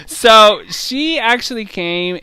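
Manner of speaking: real flattered excitement; excited. Real, not acted. Hearing fan brags about reading.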